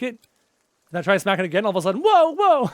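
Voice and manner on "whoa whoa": high, loud mock startle; re-enacted alarm. Retelling the surprise for viewers, mock startled. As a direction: yelp as if suddenly caught out.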